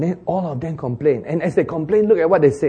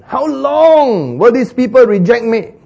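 high, loud, slow divine rebuke; the Lord rebuking his people, angry; angry God; 9 semitones higher, 8 dB louder, slower than usual. Acted. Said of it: "the Lord was really angry".

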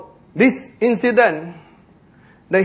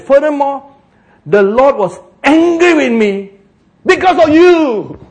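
accusing Moses; 8 semitones higher, 8 dB louder, slower than usual. Acted. Moses blaming the people.

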